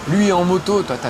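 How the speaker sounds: normal talking voice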